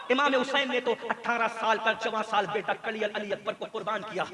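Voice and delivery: ordinary delivery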